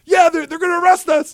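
high whiny mock voice. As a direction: whine it helplessly. Retold whiny reply.

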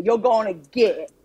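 "get" without the full Southern glide; attempted Southern pronunciation. Shown as a demonstration. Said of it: "Not get".

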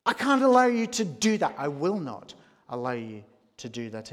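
Peter refusing, voice shooting up in outrage; incensed Peter. Retold or imitated. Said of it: "Peter is incensed and says".

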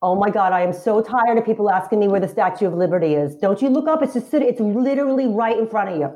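put-on fed-up Long Island accent. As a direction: complain as an exasperated Long Islander. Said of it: "Long Island".

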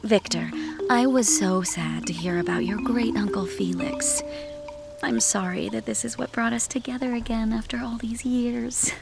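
soft, near-whispered sympathy; hushed condolence. Acted. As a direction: offer condolences in a hushed character voice. Narrator voices woman's condolences.

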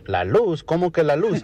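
high questioning teacher voice; puzzled teacher. Acted. Maestra questions Pepito's answer, puzzled high-pitched teacher. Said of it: "Y la maestra le dice".

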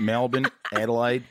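Aussie-style city names; Australian accent. Shown as a demonstration. Host teaching listeners at home, demonstrative Australian accent. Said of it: "learn an Australian accent".

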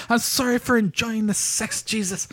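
high, slow mock-Irish confession; attempted Northern Irish accent. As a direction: apologise to God in a hammed-up high Irish accent. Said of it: "that's more Southern Irish".

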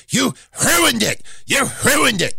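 high, breathy H in ruined, hruined; Vince McMahon voice. Retold or imitated. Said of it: "ruined in Vince McMahon's voice".

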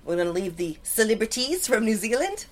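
mock Kiwi lilt, endings rising like questions; New Zealand accent. Host bidding New Zealand goodbye, mock Kiwi accent. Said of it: "the accent is real".

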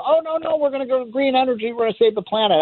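high-pitched mock objection; mocking imitation. Mocking green energy advocates' argument.

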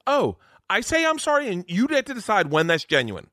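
high-pitched mock indignation; indignant wife. Husband plays wife challenged on her apology, indignant and high. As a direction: imitate an offended wife protesting that she did apologize. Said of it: "doing a great impression of her".

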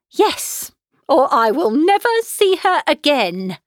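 high, loud, indignant outburst; mother flinging down an ultimatum; shrill-pitched, overwrought vow; vehement threat. A narrator's reading. Mother's threat to disown daughter.

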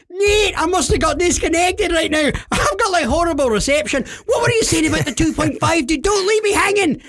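high, frantic prank-call voice; hammed-up urgency; feigned excitement. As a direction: plead like an overexcited winner.

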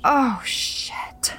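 whispered dismay. Acted. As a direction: breathe it out in dismay. Mirror breaks.

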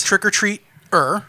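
"trick or treat er" slowly, with the final "er" set apart; hard R. Real, not acted. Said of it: "Hard R?".

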